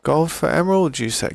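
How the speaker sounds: a light put-on bargaining tone; mock haggling query. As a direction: play the shrewd merchant weighing the offer. Haggling with a game villager.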